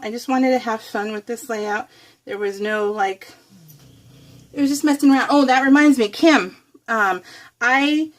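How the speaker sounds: ordinary talk, nothing marked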